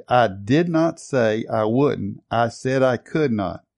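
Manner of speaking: slow, with "not" and "could" pressed; measured emphasis on "did not" and "could not"; emphatic. Retold or imitated. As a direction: lean hard on "could not", slowly. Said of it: "the Lord said emphatically".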